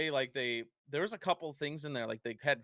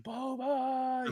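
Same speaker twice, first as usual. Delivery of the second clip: high, drawn-out fan call; adoring fan cry; 13 semitones higher than usual. Retold or imitated. Imitating fans reacting to Boba Fett, high adoring cry.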